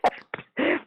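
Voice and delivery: high, laughing denial. Real, not acted. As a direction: say it through helpless laughter. Laughing at listener's joke.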